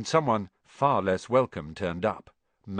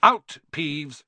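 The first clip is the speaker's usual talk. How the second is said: loud sharp bark; teacher barking at a poltergeist; barked order; 5 semitones higher, 7 dB louder than usual. Acted. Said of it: "she barked".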